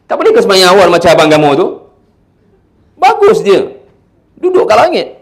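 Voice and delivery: loud, high, hectoring parent; scolding comparison. Shown as a demonstration. Parent comparing child with older brother, loud and sarcastic. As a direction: taunt the child with the sibling's example. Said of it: "Jangan banding".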